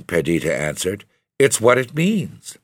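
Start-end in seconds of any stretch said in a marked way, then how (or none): 1.4-2.6 1 earnest. A narrator's reading. Perdita explains earnestly.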